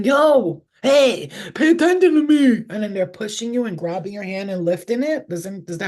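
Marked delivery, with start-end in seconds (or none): 0.0-2.6 high, loud demanding shout; yelling. Retold or imitated. Spirit yelling at a sleeper to wake, imitated yell. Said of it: "somebody's yelling".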